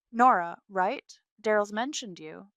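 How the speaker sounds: loud, breezy condescension from the rival; arrogant, dismissive. A narrator's reading. Mistress greeting the wife, arrogant and dismissive. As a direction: size her up with offhand superiority. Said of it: "a perfect display of arrogance and dismissiveness".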